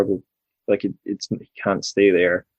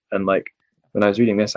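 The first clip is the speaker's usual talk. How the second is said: ordinary delivery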